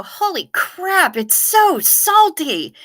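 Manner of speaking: high, drawn-out comic dismay; mock shock. Beginner snorkeler spitting out seawater, hammed-up high, slow dismay. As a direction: wail it slowly in exaggerated disbelief. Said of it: "and you go".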